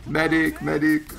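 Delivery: high called-out medic voice; medic impression. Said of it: "That's my medic impression".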